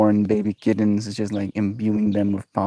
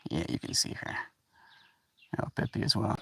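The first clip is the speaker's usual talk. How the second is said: softer, whispered; 2 semitones lower, 13 dB quieter, faster than usual. Real, not acted. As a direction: whisper so as not to disturb the cats. Quiet near the cats.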